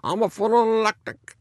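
put-on high, loud Italian accent. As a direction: imitate the patient's Italian accent. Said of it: "she would tell in this Italian accent".

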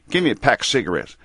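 nasal Kentucky mountain twang; Kentucky accent placed in the nose. Retold or imitated. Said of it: "use my Kentucky mountain accent".

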